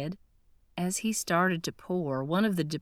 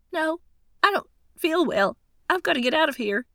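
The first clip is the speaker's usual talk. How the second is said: much higher, louder, panicked and rushed; sudden distress; 11 semitones higher, 7 dB louder, faster than usual. Acted. Woman feels sick and must flee the cafe, panicked and rushed. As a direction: gasp it out as if choking.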